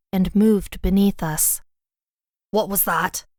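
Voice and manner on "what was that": startled, a touch of fear; frightened cry. Acted. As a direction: blurt it out in sudden alarm. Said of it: "Father Murphy cried, his eyes wide with fear".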